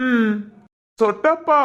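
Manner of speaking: put-on childlike man. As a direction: talk like a puzzled ten-year-old.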